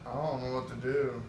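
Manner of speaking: soft, understated retold quote; subdued helplessness. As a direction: quietly imitate a man at a loss. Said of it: "He's like".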